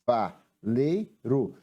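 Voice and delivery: "Ca, va, lei, ro" in separate beats; detached syllables with small gaps. Shown as a demonstration. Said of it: "então, quatro sílabas".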